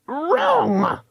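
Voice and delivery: shrill witch's screech, very high; horrible screech. A narrator's reading. Ghost hunter pouncing on the children, horrible screech. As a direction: screech it like a swooping hag. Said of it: "a horrible voice screeched".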